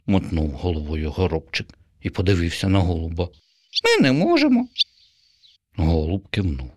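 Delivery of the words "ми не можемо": very high, louder sparrow; firm little refusal. Acted. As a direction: sparrow refusing for both birds.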